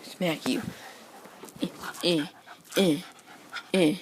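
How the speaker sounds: soft playful taunt; quiet teasing. Real, not acted. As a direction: hush it while poking the dog.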